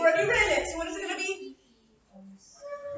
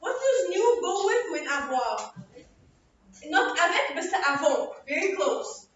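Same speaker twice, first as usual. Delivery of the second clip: usual speaking voice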